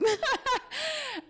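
high incredulous laugh. Real, not acted. Daughter recalling dad's doubt, high laugh. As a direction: laugh at how absurd it was.

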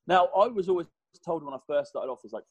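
ordinary talk, nothing marked

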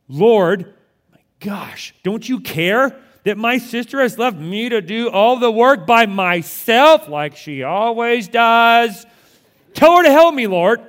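loud, whining, with a huffy whispered "my gosh"; exasperated sibling complaint. Shown as a demonstration. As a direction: rant like a fed-up big sister at her brother. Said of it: "read it in a more accurate tone".

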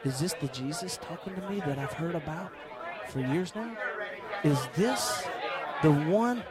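soft, low awestruck wonder; awed. Acted. Blind beggar meeting Jesus face to face, hushed awe.

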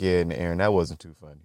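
low, quiet dad voice. Retold or imitated. Dad chides Aaron for the cripple joke, low and soft. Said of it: "my dad was like".

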